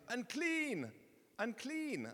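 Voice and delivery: high-pitched warning call; shouting. Acted. Rejected leper to people avoiding him, shouting. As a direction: imitate a leper calling out a warning.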